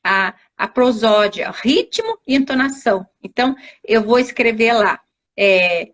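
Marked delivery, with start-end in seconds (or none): none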